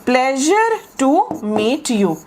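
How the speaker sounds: drawn-out and deliberate; teacher dictating the phrase for students to write; very slow model phrase. Shown as a demonstration. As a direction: stretch every word for learners. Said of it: "ही लिख देते हैं हम".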